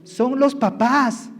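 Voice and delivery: loud, high preaching to parents; preacher urging fathers and mothers, loud; fervent preaching. Acted. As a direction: drive the point home to the congregation. Preaching at full force.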